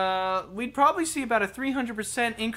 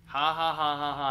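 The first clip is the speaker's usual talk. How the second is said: sarcastic laugh; 6 semitones lower than usual. Real, not acted. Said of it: "never heard that one before".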